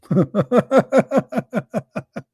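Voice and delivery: high mocking cackle; mocking laugh. Real, not acted. Mocking the callers' reply, high laugh.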